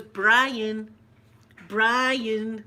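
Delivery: loud, drawn-out mock call; imitated calling out. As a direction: call out a customer's name across the room. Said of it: "like that".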